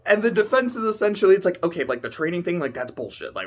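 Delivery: normal talking voice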